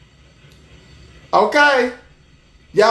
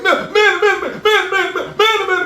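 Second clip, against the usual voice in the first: high, laughing mock chant of "man"; mock fan; 8 semitones higher than usual. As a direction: squeal "man" over and over in jest. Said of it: "they go".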